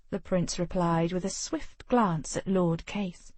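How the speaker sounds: ordinary delivery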